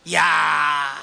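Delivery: a sudden loud cry of astonishment; exclaiming shout. Retold or imitated. Preacher telling his story to the congregation, bursts into a shout. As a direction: burst out with a yell.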